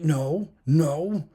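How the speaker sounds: embarrassed protest. Retold or imitated. Brother's embarrassed denial.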